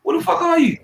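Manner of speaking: high, loud disbelief; shocked what-the-hell outburst; incredulous outburst. Real, not acted. Exasperated outburst at interrupter.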